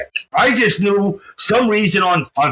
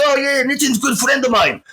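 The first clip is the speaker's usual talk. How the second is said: higher-pitched imitated Czechoslovakian accent; Czechoslovakian kid; 8 semitones higher than usual. Czechoslovakian kid vouching for his friend Nitten, imitated accent. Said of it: "this Czechoslovakian kid comes out to me".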